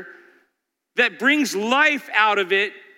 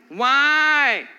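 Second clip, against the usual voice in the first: put-on whiny child; 4 semitones higher than usual. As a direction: whine it even more. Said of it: "Doesn't that sound whiny?".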